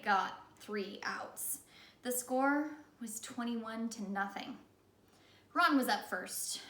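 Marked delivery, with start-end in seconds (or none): none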